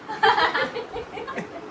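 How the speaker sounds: high-pitched laughing fit; high giggling burst; real laughter. Real, not acted. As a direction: laugh freely, high and light. Laughs after teasing remark.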